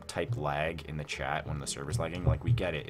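usual speaking voice

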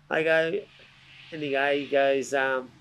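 ordinary talk

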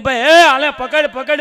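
mock panicked shout; alarmed call. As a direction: urge him to grab it quickly. Alarm at kerosene can.

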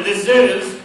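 boxing ring announcer. Acted. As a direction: stretch out the name for the crowd. Calling the fighter's name.

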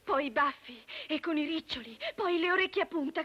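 normal talking voice

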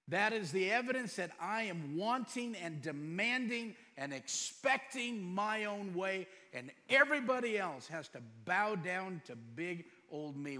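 rally the congregation hard; full-force sermon delivery; fiery preaching. Acted.

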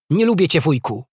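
put-on high, loud childish outburst; sulky little girl. A narrator's reading. Little princess stamping at her uncle, sulky and high. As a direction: pout it out in a child's voice.

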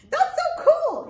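high, loud little puppet marvelling; squeaky puppet voice. Acted. Puppet Arturo marvels at reading to the kids, high squeaky voice.

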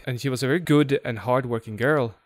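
plain reading voice

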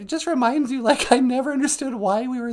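high puppet-like voice; high-pitched sidekick musing; squeaky sidekick. Pretend. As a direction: chat in a piping comic voice.

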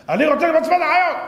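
high, loud stubborn shout; insistent demand. Acted. Servant insisting to King Solomon, loud and demanding. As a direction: demand it like an impatient pleader.

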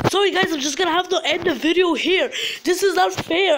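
high, whining distress; upset complaint. Real, not acted. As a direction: whine that it's all unfair. Gives up on broken game.

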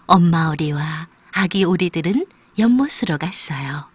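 usual speaking voice

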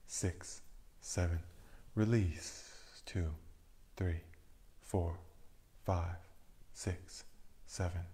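soft, sinking counts with the cue words lifted; guided breath counting. Acted. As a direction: count the breaths calmly, letting each number fall away. Leads a breathing meditation.

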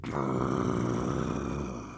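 deep low growl; tiger growl. Acted. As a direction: growl back like angry tigers. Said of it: "the tigers only answered".

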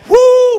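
sudden high, loud falsetto whoop; high ecstatic woo. Acted. Preacher whooping as prayer gets answered, high loud whoop. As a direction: let out a joyful whoop.

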